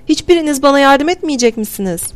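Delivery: high-pitched, pleading outcry; woman crying out. A narrator's reading. Woman begging the bystanders for help, crying out. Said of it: "Kadın bağırdı".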